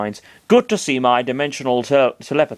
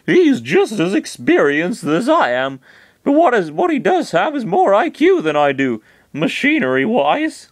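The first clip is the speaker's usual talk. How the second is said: Chris's voice; 8 semitones higher, 4 dB louder than usual. Acted. Chris answers Master Hand's scolding, defensive and higher.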